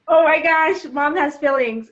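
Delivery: mock-amazed daughter discovering mom has feelings; imitating daughter. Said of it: "And she's like".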